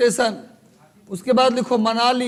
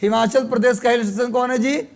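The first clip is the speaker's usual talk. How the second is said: normal talking voice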